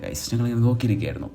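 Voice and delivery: ordinary delivery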